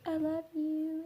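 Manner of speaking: high, soft voice for the dog saying I love you; Monty voiced tenderly, high and soft; dog's loving voice. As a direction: speak as the sweet dog comforting her. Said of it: "Monty's gonna come up to me and be like".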